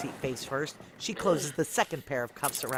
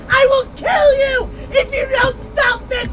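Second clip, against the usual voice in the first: slow, shrieked very high and loud; crying, enraged scream; screaming threat; 15 dB louder than usual. Acted. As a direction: scream the threat in raw fury. Threatening in desperation.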